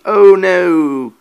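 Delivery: high, loud "oh no"; alarmed exclamation. Real, not acted. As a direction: blurt it out in sudden alarm. Character discovered, narrator exclaims.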